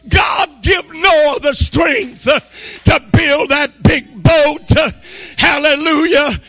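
loud, high preacher's shout; fervent, high-pitched sermon shouting; full-force preaching. Real, not acted. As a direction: preach it at the top of your voice.

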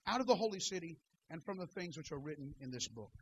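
soft quick King James reading; scripture reading. Real, not acted. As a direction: read the passage low and brisk. Reads scripture quietly, fast.

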